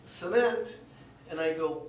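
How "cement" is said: high, louder puzzled question; puzzled cement. Retold or imitated. Mitz sees the truck's cargo, baffled. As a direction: ask it baffled, pitch jumping up.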